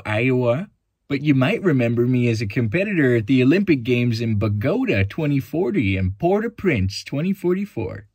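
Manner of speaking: high, loud, wholesome Midwestern athlete; Lewis, Midwestern farm boy. Acted. Olympic finalist greets the audience as a corny Midwestern farm boy. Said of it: "Lewis has a Midwestern accent, is a wholesome and corny farm boy".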